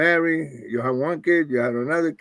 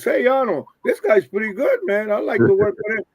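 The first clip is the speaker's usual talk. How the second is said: calling out; 7 semitones higher than usual. Retold or imitated. Promoter calling to Arnold again.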